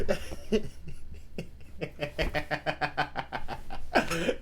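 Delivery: long breathy giggling fit; laughter. Real, not acted. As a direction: laugh helplessly at being caught out. Amused by the video's remark.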